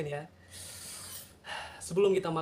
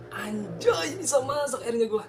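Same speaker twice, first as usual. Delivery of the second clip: proud, higher and louder; excited; 8 semitones higher, 5 dB louder, slower than usual. Real, not acted. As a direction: cheer about finally cooking. Proud he can cook.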